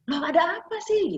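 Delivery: high, alarmed question; a startled cry of surprise. Retold or imitated. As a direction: jump up in shock at the news. Alarmed reaction retold.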